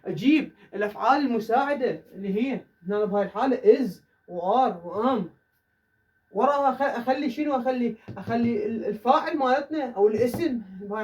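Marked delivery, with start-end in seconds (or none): 3.9-5.2 slow and careful, each verb on its own; slow classroom listing. Shown as a demonstration. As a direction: list them slowly for the class. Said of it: "teacher lists the helping verbs".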